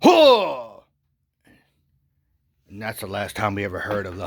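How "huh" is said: high, loud yelp; a sudden shocked cry; startled yelp. Retold or imitated. Husband attacked by the bear, a loud yelp. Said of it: "he's like".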